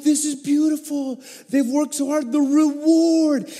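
high, choked-up voice on the edge of tears; tearing up. Retold or imitated. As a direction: let the emotion well up, voice rising. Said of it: "I start tearing up".

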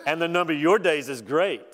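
exaggerated sarcastic praise. God mocking Job about his great age, sarcastic. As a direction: lay the sarcasm on with heavy emphasis. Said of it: "he's being sarcastic".

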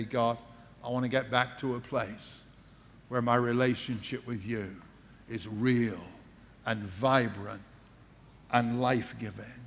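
hushed, intimate prayer; quiet prayer. Acted. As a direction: pray it softly to God. Voiced prayer to God.

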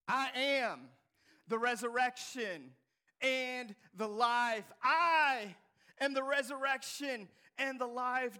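loud and slow, every word weighted; bold declaration. Shown as a demonstration. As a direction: proclaim it with full force. Repeated loudly after whisper.

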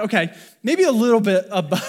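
loud, high, breaking into a laugh; amused, voice lifting into open laughter. Real, not acted. Amused at a too-simple answer.

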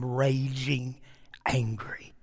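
slow, low and soft; hushed intensity. Real, not acted. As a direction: let the words sink slowly into a near-hush. Preacher stresses the dean's anger.

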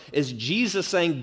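usual speaking voice

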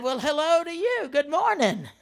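loud, high, exaggerated hello; pointed morning greeting. Preacher greeting the man next morning, exaggerated and pointed. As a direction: greet him with big mock cheer.

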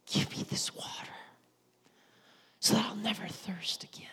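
whispered, sincere plea for the water; earnest whisper. Retold or imitated. Woman asking Jesus for water, in a reverent whisper. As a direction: breathe the request softly and earnestly. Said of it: "I don't know if she said".

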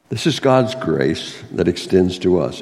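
normal talking voice

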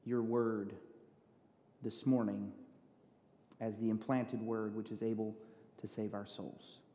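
soft, hushed pastoral prayer; quiet prayer. Acted. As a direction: pray it softly and reverently. Opening prayer before sermon.

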